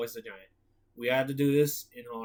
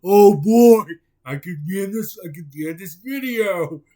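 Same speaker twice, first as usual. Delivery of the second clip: excited Patrick; 3 semitones higher, 13 dB louder than usual. Acted.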